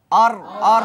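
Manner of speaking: loud, sung-out আর আর with the vowel held; pronunciation drill. Shown as a demonstration. Teacher leads class, loud drawn-out আর syllable drill. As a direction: call out আর slowly for all to repeat. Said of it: "এক নম্বর উচ্চারণ সবাই করুন".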